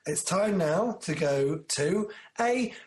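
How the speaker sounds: slow build to a high yelp; announcer build-up. Acted. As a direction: drag it out then squeal the last word. Announcing the song break.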